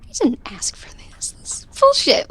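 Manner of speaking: whispered frustrated grumble; under his breath. Retold or imitated. Aspen grumbling about the book, under his breath. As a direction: mutter it to yourself, unheard. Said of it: "under his breath".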